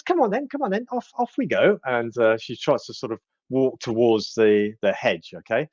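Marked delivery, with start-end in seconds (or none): 0.0-1.8 high fast fussing granny voice; sweet old lady. Acted. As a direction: fuss over the old fellow in a high quick voice. Kettlestein voiced, herding Burley.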